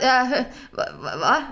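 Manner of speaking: stammered, baffled "huh?"; mock confusion. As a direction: imitate someone totally thrown, stumbling on one word. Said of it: "han blev jätteförvirrad".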